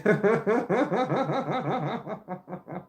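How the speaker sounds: asked through a real laugh, then a long laugh. Real, not acted. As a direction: tease the viewer and crack up. Teasing viewer, laughs.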